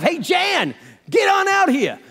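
high, loud call to the back room. Retold or imitated. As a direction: holler for his wife across the diner. He calls his wife.

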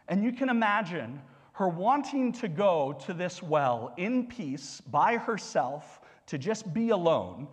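ordinary talk

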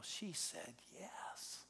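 whispered reveal; whispered wonder; hushed whisper. Real, not acted. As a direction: breathe it out in awe. Dramatic reveal of conversion.

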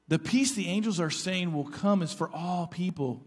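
normal talking voice

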